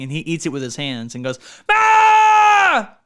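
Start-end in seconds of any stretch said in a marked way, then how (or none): 1.7-3.0 loud, high imitation scream. Host playing the screaming movie character, full scream. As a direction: yell like someone who can only scream. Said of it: "the only way he communicates is by screaming".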